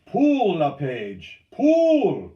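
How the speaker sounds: high, forceful drawn-out shouts; urgent yell. A narrator's reading. Steersman drives a failing paddler on, yelling. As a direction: crack the order like a whiplash. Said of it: "Charbonneau yelled".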